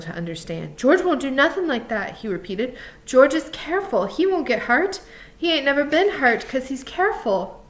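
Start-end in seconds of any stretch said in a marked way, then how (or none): 0.8-2.2 higher, louder protest; insistent Lenny. A narrator's reading. Lenny struggling to understand, stubbornly insistent. Lenny's dialogue in story narration. 3.1-7.6 high-pitched earnest insistence; insistent Lenny, protesting. A narrator's reading. Lenny defending George to Crooks, insistent. Lenny's dialogue in story narration.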